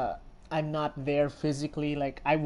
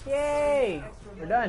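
high, loud happy yay; excited cheer; 8 semitones higher, 5 dB louder, slower than usual. Real, not acted. Streamer celebrating finishing the mission, loud excited cheer.

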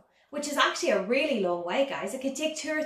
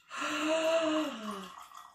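mock gasp; 4 semitones higher, 4 dB quieter than usual. Reveals juice trick.

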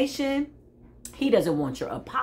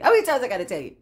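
louder, insistent repeat; emphatic repeat; 5 dB louder, much faster than usual. Real, not acted. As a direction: drive the question home louder. Repeats the question with rising force.

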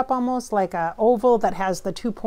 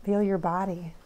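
guided breathing; 7 dB quieter, slower than usual. Acted. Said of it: "You guys do this with me".

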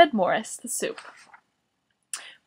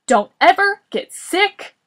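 loud, high, emphatic order; exasperated shout; 4 semitones higher, 8 dB louder than usual. A narrator's reading. Boris demanding one favour of Morris, exasperated shout. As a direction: land each word with force.

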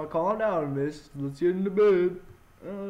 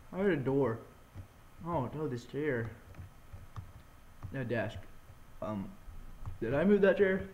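normal talking voice

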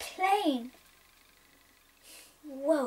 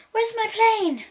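high loud alarmed gasp and cry; startled outcry; shocked gasp; 7 semitones higher, 10 dB louder than usual. Acted. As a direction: discover the plane is gone.

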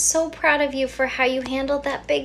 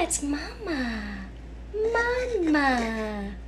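normal talking voice

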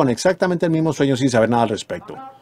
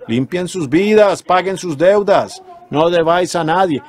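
raised, drawn-out pulpit commands; preaching exhortation; 4 semitones higher, slower than usual. Real, not acted. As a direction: urge the congregation in high, slow phrases. Exhorts the congregation. (exhortación de predicador, aguda y pausada)

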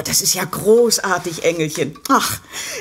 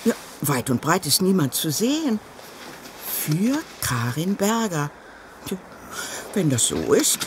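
ordinary talk, nothing marked

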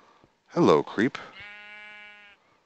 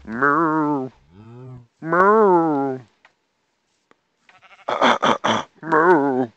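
high, loud mock moo; cow moo; 10 semitones higher, 9 dB louder, much slower than usual. Mooing at the cows.

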